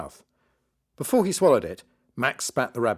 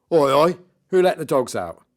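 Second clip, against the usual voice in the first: loud, higher stage shout; heckling magician; 5 semitones higher, 8 dB louder than usual. Acted. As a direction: call it out cockily to the crowd. Magician heckles the arriving monster.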